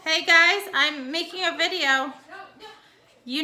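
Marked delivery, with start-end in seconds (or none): 0.0-2.1 calling out. Real, not acted. Teacher calling out to kids interrupting the recording, loud. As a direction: raise the voice to get their attention.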